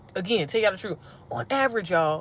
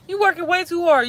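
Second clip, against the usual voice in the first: "You working way too hard" sung out much higher; high sing-song; 9 semitones higher than usual. Shown as a demonstration. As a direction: lift the line way up, teasing. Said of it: "you working hard".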